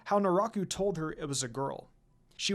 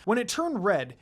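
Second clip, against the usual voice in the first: ordinary delivery, nothing marked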